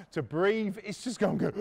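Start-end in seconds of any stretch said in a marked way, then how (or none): none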